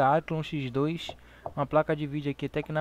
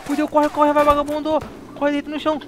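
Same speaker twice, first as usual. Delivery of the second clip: much higher, louder panicked yelling; panicking gamer; 11 semitones higher, 8 dB louder, faster than usual. Real, not acted. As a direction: scream it like you're about to die. Under fire, yelling at teammate.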